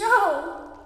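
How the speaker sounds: sudden high, loud wail of denial; anguished cry. Acted. Ebony learning Draco is dead, loud anguished cry. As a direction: scream it out in shock.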